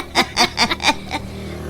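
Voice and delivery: soft breathy giggle; laugh. Acted. Teasing the brother about supporting the child, giggling.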